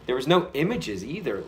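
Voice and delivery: ordinary talk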